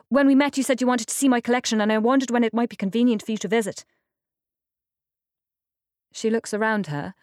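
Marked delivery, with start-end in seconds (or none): 0.1-3.8 fast, higher and louder rush; too quickly. A narrator's reading. Silas hurriedly inviting Iris to see his collection. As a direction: gabble the invitation in one breath. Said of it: "He says too quickly".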